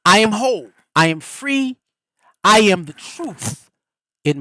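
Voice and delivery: strong affirmations for the listeners. Shown as a demonstration. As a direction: proclaim each I am with conviction.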